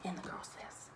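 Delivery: hushed, breathy whisper; whispered aside. Real, not acted. Mother whispering to her cousin about the little girl, hushed. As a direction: drop to a whisper.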